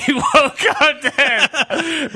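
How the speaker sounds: high, loud repeat through laughter; laughing. Real, not acted. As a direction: crack up while repeating it, drawn out. Cracks up at a slip.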